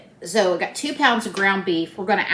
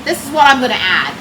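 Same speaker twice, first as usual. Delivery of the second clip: raised voice over background noise; speaking louder; 2 semitones higher, 7 dB louder than usual. Real, not acted. Cook repeating for viewers over the central air, speaking louder. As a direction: project a little to be heard. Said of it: "I'll speak louder".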